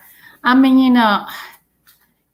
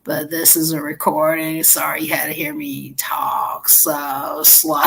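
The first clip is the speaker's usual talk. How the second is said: slow, drawn-out fake recording; mock recorded message, slowed down; slow talk; 3 semitones lower, 3 dB quieter than usual. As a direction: talk real slow like a machine. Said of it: "I'll talk real slow".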